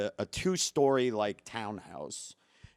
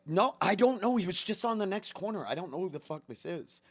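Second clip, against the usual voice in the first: imitated flustered denial; 7 semitones higher than usual. Wife denying to husband that she knows the man, flustered. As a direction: protest quickly that you don't know him. Wife denies knowing him, acted.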